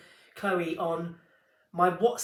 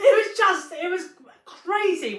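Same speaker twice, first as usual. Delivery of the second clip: laughing; 12 semitones higher, 9 dB louder than usual. Real, not acted. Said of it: "laughing while retelling".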